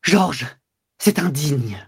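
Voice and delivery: high, shocked gasp then reproach; shocked cry. A narrator's reading. Mistress recoiling from Georges's cruel remark. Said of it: "Elle recula".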